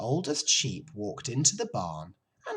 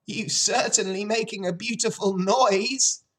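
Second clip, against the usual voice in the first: high, loud sheep snap; snappish old sheep; 11 semitones higher, 6 dB louder than usual. A narrator's reading. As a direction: snap sarcastically at the wailing pig. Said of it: "snapped the old sheep".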